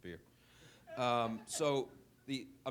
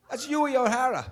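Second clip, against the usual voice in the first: imitating an Irish pub fan, much higher and louder; Irish accent; 15 dB louder than usual. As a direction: belt it like a proud Irish regular. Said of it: "He goes".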